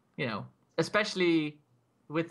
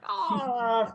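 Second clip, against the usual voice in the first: high, loud wail, falling away; mock pain cry; 9 semitones higher, 5 dB louder than usual. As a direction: imitate someone writhing in pain. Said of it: "he was still squirming in pain".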